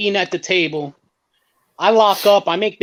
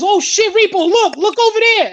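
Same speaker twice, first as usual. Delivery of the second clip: excited exclaim; 10 semitones higher, 4 dB louder than usual. Retold or imitated. Manny spots the fake car.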